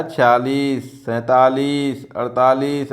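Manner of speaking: slow, deliberate number by number; slow counting demo. Shown as a demonstration. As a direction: count them out slowly for the class. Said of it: "ऐसे बोला जाता है". (धीरे-धीरे गिनकर बोलना)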